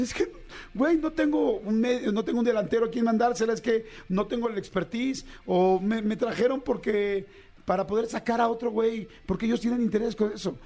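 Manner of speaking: a player on the pitch defending himself; voicing a frustrated player. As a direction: plead like the blamed striker.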